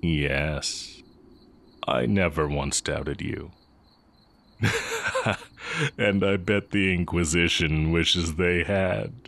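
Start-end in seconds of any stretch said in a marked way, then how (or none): 4.6-5.9 amused laugh. Real, not acted. Amused at the Inquisition joke.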